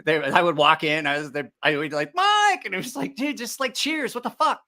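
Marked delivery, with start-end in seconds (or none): 2.2-4.6 high, excited bar shout; mock rowdy welcome; cheerful greeting. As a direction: call out like the regulars did. Bar regulars greeting him.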